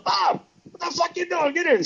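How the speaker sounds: high shouted call across the room; yelling. Retold or imitated. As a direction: shout him in like an impatient star. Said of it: "he yells".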